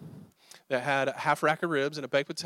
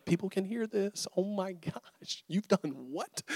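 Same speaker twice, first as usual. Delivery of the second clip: softer, slowed, mortified aside; embarrassed inner thought; 4 semitones higher, 7 dB quieter, slower than usual. Retold or imitated. Preacher mortified as friend confesses aloud, hushed and slowed. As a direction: hush it like the whole restaurant hears.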